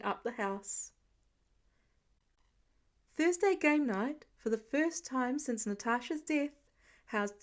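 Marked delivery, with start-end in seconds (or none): none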